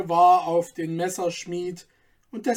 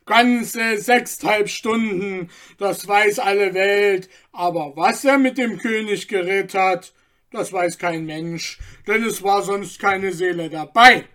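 louder, pressing townsman; eager gossip; 6 dB louder than usual. A narrator's reading. Bystander insists to the crowd, loud and eager. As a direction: lay it out for all to hear.

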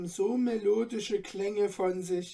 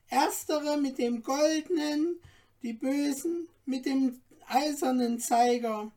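high, eager little dwarf guide; courteous little dwarf; 7 semitones higher than usual. A narrator's reading. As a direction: play the tiny helpful dwarf showing off treasures. Dwarf explains the clock.

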